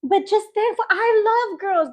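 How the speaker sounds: high-pitched mom pleading her case; high, insistent mother impression; imitating mom. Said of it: "She was like".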